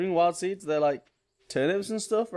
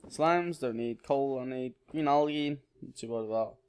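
ordinary talk

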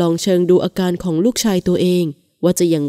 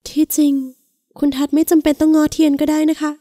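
put-on much higher, gentle young woman; wounded wife; 8 semitones higher than usual. A narrator's reading. Wife telling her husband he needn't coax her: higher, hesitant. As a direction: let him off, higher and hesitant. Wife declines his coaxing.

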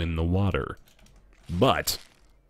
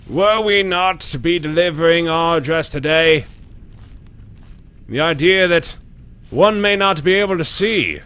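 Lincoln impression; 5 semitones higher, 3 dB louder than usual. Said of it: "do a Lincoln impression".

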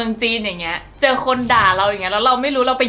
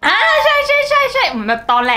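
laughing; 13 semitones higher than usual. Real, not acted. Laughing at the insult.

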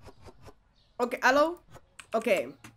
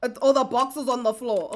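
normal talking voice

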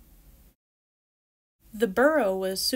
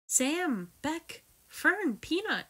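joyful calling; 9 semitones higher, slower than usual. Acted. Friends meet again, calling names.

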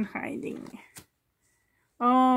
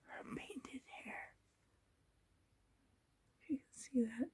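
whisper; 20 dB quieter, faster than usual. Real, not acted. Showing close detail of doll.

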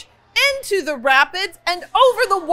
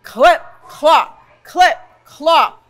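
loud, heavy clip clop; heavy hoofbeats; 7 dB louder, slower than usual. A narrator's reading. Narrator sounds the biggest goat's hooves on the bridge, loud. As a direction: stomp the clip clop out like a huge goat. The big goat crosses.

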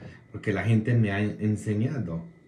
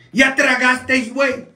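much higher, much louder rough mock shout; slangy Oaxaca voice; 13 semitones higher, 8 dB louder, slower than usual. Oaxaca family asking if he ate, loud slangy mock voice. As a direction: bellow it like a rowdy uncle. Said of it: "Decían".

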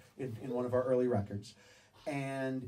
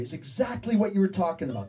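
ordinary talk

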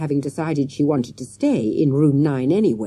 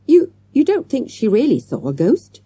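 high, startled question with a stumble; stuttering surprise; 8 semitones higher, 6 dB louder than usual. A narrator's reading. Boy questioning his friend about a ghost, stuttering in surprise. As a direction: stumble on the first word, astonished. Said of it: "Matthew stuttered in surprise".

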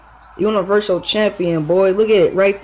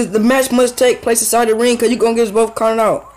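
ordinary talk, nothing marked

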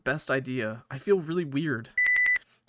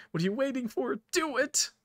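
much higher, pushing plea; desperate urging; 10 semitones higher than usual. Acted. Button urging her friend to finish it, very high and desperate. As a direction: force them to act, voice climbing.

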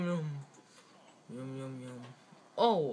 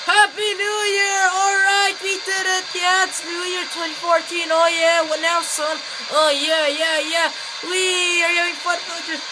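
high, loud celebration shouts; excited cheering; 8 semitones higher, 6 dB louder than usual. Pretend. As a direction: cheer like it just struck midnight.

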